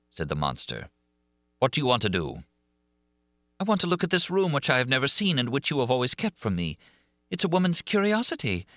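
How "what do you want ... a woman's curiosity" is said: forced playful. A narrator's reading. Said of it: "tone which she tried to render playful".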